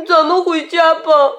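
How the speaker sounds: tearful. A narrator's reading. Xiaopang begs the rabbit to go home, mildly tearful. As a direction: plead to go as if near tears. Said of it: "小胖哭着说".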